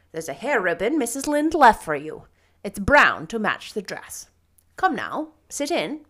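loud, swooping, disapproving voice; stern practical guardian. Acted. Marilla tells Anne she didn't need a dress, stern. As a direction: lay it down briskly and emphatically.

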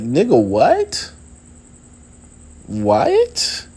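high squeaked disbelief, rising questions; incredulous. Retold or imitated. Answering a friend mad about your We Ran, incredulous. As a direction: push each question way up high, baffled.

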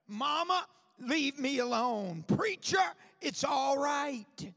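preacher mimicking a defiant teen brushing off mama and the preacher; defiant youth. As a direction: throw it back like a sulky kid. Preacher voices defiant young people.

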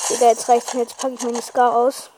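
loud fed-up declaration. Real, not acted. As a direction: say it loud, losing patience. Fed up in the fight.

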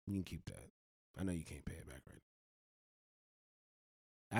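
mock easygoing Sally Mae; lender voiced as a friend. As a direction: play the loan company as a chill buddy letting you off. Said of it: "Sally Mae come to your door personally and say".

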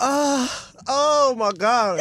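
high, loud drawn-out mock moan; mimicked moaning.

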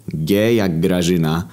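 usual speaking voice

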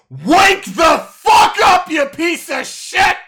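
full-force high, drawn-out yell; furious shout. Acted. Game master as a man slapping Jed awake, furious shout. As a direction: scream it out at the top of your voice, slowly.

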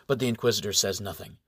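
usual speaking voice

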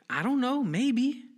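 high, loud taunting answer; cheeky comeback. Retold or imitated. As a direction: kid daring a cop, pitch shooting up. Retold cheeky answer to cop's challenge.

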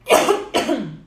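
sudden choking cough fit. Real, not acted. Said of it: "Me engasguei feio aqui".

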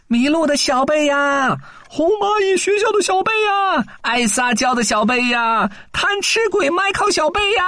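put-on high searching call for the lost child. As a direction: call his name across the field. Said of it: "跟大家一起喊".

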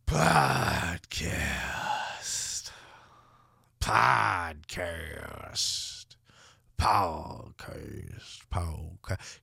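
a fake reverb trailing off into the distance; mock echo. Host echoing his show title, low fading echo.

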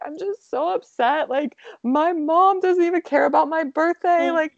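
high, whiny, wounded complaint; a hammed-up upset wail; imitating her upset self. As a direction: replay your own hurt whine to your husband. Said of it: "I was like".

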